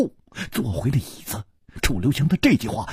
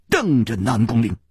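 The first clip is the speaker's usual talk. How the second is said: plain reading voice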